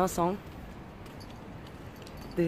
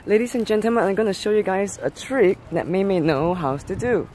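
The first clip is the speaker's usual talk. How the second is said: ordinary talk, nothing marked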